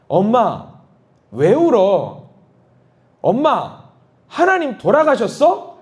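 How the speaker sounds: 높은 목소리로 엄마에게 묻는 어린 딸; 걱정스레 조르듯 묻는 아이처럼; 어린 딸 목소리. Retold or imitated. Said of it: "엄마한테 이렇게 말합니다". (울던 엄마에게 묻는 딸, 높고 애틋한 아이 목소리)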